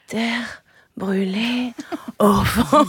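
slow breathy whisper; seductive whisper. Pretend. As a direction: whisper it slowly in bed. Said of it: "lui susurrer au lit".

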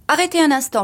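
high-pitched order to stop; calling a halt. Acted. Husband telling the chauffeur to stop, urgent call. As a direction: call it out urgently, then ease off.

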